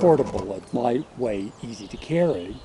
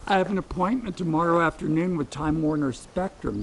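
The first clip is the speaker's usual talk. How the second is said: ordinary delivery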